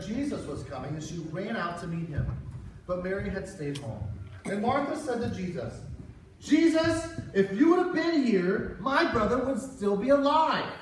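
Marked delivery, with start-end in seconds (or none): 6.4-10.7 angry. Acted. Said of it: "Martha wasn't very happy with Jesus".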